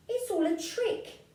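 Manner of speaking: put-on high, bright child insisting; a hint of a defiant child, pitched high; child's voice. Gruffalo's child dismisses the mouse story, high-pitched. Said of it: "said the Gruffalo's child".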